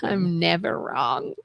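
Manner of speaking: mock smug murmur. Teasing closing banter.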